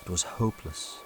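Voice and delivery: ordinary delivery, nothing marked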